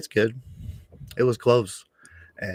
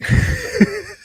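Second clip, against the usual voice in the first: laugh; 6 dB louder than usual. Real, not acted.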